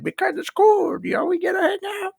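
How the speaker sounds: imitating his younger self; mock kid voice. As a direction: whine it like a young boy. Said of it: "And I'm like".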